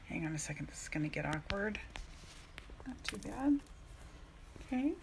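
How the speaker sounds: fast, soft whisper to herself; whispered aside. Real, not acted. Coach whispering while struggling to open a package. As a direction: mutter it under your breath quickly.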